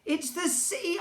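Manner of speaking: high, eager explanation; eager boy. A narrator's reading. Narrator boy explaining the sea, high and eager. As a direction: start to explain in a hurry.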